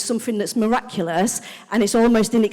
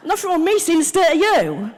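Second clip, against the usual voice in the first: louder, higher defiant comeback; mock aggressive retort; 7 semitones higher, 4 dB louder than usual. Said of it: "my natural response".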